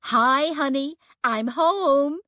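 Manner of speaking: bad fake American accent, bright and breezy; poor American accent. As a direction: put on a clumsy American accent, cheerfully. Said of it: "in a poor attempt at an American accent".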